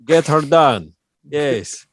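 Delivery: ordinary delivery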